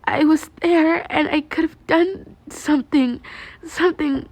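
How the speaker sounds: put-on high, slow, trembling girl's voice; a girl's voice, pained and slow; guilty confession. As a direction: confess it as if it hurts. Girl confessing guilt, near tears.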